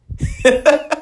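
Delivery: high burst of real laughter; helpless high-pitched laugh. Real, not acted. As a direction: break up laughing in disbelief. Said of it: "I'm sorry for laughing".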